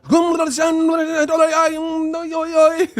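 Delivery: very high, loud babbling rant; frenzied gibberish voice. Retold or imitated. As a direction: shriek it out in a frenzy. Imitating boxer's frenzy.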